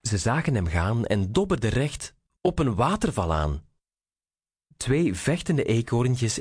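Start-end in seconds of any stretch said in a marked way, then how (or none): none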